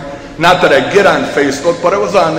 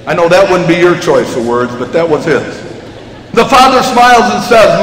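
ordinary talk

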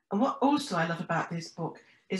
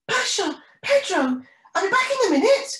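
high-pitched, louder penguin character call; young penguin calling out; 14 semitones higher, 6 dB louder than usual. Acted. Penny calls out to her siblings.

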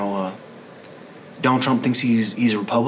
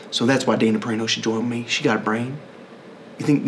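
normal talking voice

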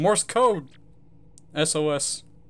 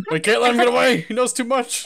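much louder and higher, urgent; urgent alarm; 8 semitones higher, 6 dB louder than usual. Acted. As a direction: sound the alarm, big and high.

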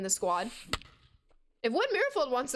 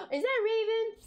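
excited gasp; 10 semitones higher than usual. Real, not acted. Gasps, spots her pet.

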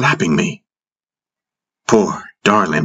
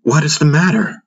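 higher-pitched, anxious question; lightly voiced worried mother; concerned mother; 9 semitones higher than usual. A narrator's reading. Mother Suan asks the child who ran to her, concerned.

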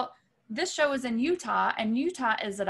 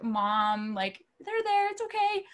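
much higher, coaxing sing-song; mock soothing; 6 semitones higher, slower than usual. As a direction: coo at a tantrum. Crew acting like parents.

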